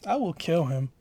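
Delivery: ordinary talk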